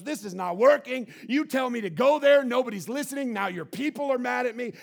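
loud frustrated outburst; angry venting. Acted. Moses venting his anger at God, loud outburst. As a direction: dump all the anger out. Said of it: "dumps all of his anger and goes".

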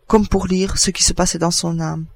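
ordinary delivery, nothing marked

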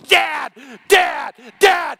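high, loud calling out Dad across a crowd; shouting for dad. Retold or imitated. Son spots his dad in the gym, shouting. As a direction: call out to him across a packed gym.

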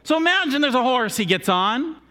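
ordinary delivery

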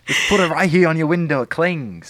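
loud, high mock infomercial sales pitch; Anthony Sullivan. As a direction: hawk the product like a TV pitchman. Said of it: "sounds like an infomercial kind of product".

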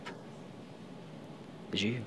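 barely voiced, hushed whisper. Real, not acted. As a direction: let it fall to a breathless whisper. Quiet climax of sermon point.

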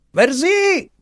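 very high-pitched call to the servant. A narrator's reading. Little master orders Marianna to open, calling out. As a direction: call upstairs in a piercing high voice.

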